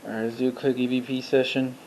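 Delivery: ordinary talk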